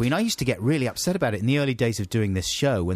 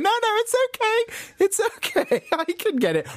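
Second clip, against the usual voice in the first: put-on squeaky high little old lady protesting politely. Old lady on the train politely refusing help, high squeak. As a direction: imitate a flustered old lady, pitched way up.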